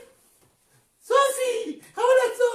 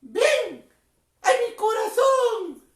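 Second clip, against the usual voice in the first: call to repeat; much slower than usual. Shown as a demonstration. Clown leads children in a prayer line, slow call-out. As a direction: model it slowly for the children to echo. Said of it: "díganle".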